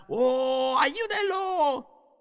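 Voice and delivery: high, drawn-out panicked cry; a boy screaming at his injured brother, high and stretched out; child's scream for help. Retold or imitated. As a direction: shriek it like a scared little boy begging for help. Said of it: "yo empecé a gritar".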